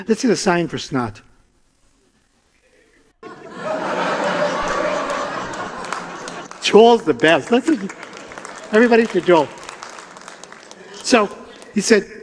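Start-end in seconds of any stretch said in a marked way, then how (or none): 3.2-6.6 breathy high laugh; hearty laugh. Real, not acted. Storyteller cracking up at the snot sign, breathy laugh. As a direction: burst out laughing at the joke.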